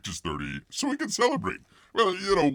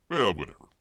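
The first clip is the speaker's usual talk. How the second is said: normal talking voice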